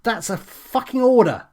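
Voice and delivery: snapping at the assistant, a furious command; angry shout. A narrator's reading. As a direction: bark it like a stormtrooper. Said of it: "I shouted".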